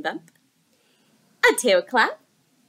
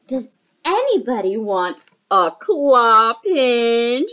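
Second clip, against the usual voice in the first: slow loud crab voice; snappy clicking crab; 8 dB louder, slower than usual. Acted. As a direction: drawl it like a crab. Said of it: "said the crab".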